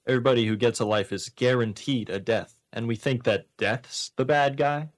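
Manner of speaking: ordinary talk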